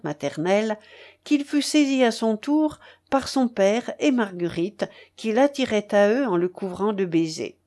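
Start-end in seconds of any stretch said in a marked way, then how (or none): none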